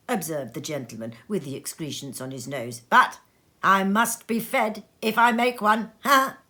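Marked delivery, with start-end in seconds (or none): 2.9-5.9 louder, pushy joking demand; a touch of a greedy diner; greedy gentleman. Acted. Red-faced gentleman jokes. 6.0-6.4 loud single bark of laughter; one burst of a laugh; short laugh. Acted. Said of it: "Another laugh".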